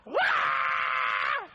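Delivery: high hammed-up wail; mock wailing cry. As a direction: fake a baby's cry.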